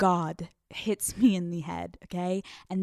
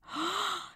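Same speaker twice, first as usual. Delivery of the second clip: high, soft theatrical gasp; feigned gasp; 11 semitones higher, 8 dB quieter than usual. Acted. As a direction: suck in air in pretend surprise. Acting shock on seeing him.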